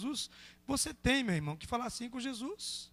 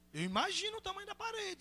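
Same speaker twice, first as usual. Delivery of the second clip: hushed high swoop of mock amazement; high-pitched wonder; 10 semitones higher, 4 dB quieter than usual. Real, not acted. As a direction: marvel quietly in a lifted voice. Marked repeat of the remark.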